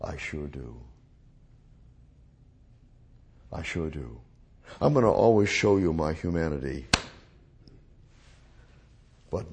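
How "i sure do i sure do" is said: Lord's quiet answer. Retold or imitated.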